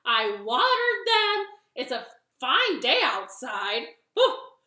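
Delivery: high, very loud frustrated tirade; mad yelling. Acted. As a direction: yell at the seeds with drawn-out cries. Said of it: "he yelled and yelled and yelled".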